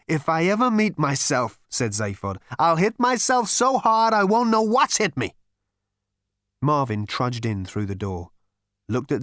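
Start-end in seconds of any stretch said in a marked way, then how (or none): 0.1-1.6 loud, high, heated threat; exasperated. Acted. Zaphod's exasperated threat to himself. 2.6-5.4 loud, very high rant; exasperated threat. Acted. Zaphod's exasperated threat to himself.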